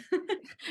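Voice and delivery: high bright laugh breaking out; burst of laughter. Real, not acted. Cohost laughing at her own rambling, a real laugh.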